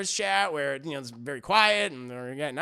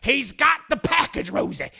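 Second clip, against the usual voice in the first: raised, loud imitated shout; 6 semitones higher, 5 dB louder than usual. Husband bursts in on Rosie, imitated shouting. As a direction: call it out urgently, pitched up. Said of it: "he's shouting".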